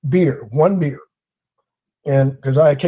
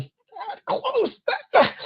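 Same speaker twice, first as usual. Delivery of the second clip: high, breathy squeak of that close; showing the gap, squeaked up high; mock that close; 6 dB quieter, much slower than usual. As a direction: squeeze the words up tiny. Mimicking officer's tiny gesture.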